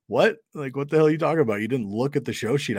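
high, fast, incredulous outburst; shocked disbelief. Retold or imitated. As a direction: blurt it out in stunned outrage. Retold shock at guest's reply.

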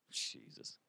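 whisper. Real, not acted. Whispered aside.

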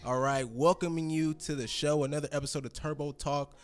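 ordinary talk, nothing marked

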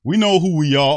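loud, high mocking read-out; mocking quote. Fan reads player's statement back in loud, high mockery. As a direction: blurt the quote out in mock indignation.

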